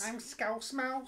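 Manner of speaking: high, slow squeaky mouse; a little cartoon mouse introducing himself.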